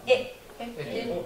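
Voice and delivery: Et with a short clipped e; Et, cut short, no lengthening; short vowel. Shown as a demonstration. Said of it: "vous ne devez pas allonger".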